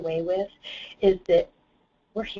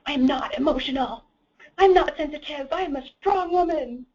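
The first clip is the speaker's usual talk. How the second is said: higher, louder mock indignation; indignant protest; 8 semitones higher, 4 dB louder than usual. Retelling her angry reply to dad, raised indignant protest. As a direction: insist hotly that you're not emotional. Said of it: "I'm like".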